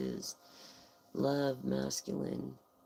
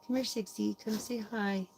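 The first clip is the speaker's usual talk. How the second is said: higher sing-song coaxing call; calling the pet; 8 semitones higher than usual. Real, not acted. Streamer coaxing Sixty to say hi to viewers, higher sing-song call. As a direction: coax the pet over sweetly.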